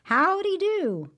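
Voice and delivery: high, loud, slow howdy; shouted greeting. A narrator's reading. Brer Rabbit shouting a greeting to the tar baby, high and loud. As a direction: call it out across the road. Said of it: "he shouted out to that tar baby".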